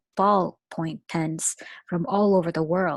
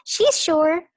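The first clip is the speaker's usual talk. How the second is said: much higher, loud quick insistence; eager kids; 10 semitones higher, 8 dB louder than usual. Acted. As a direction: blurt it out eagerly.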